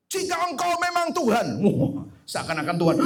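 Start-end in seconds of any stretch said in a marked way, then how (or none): 0.1-1.5 doa menantang ditiru. Retold or imitated. Meniru doa yang menantang Tuhan.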